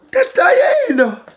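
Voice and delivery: high, drawn-out excited call; excited shout. Real, not acted. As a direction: announce it with delight. Excited reveal of doll.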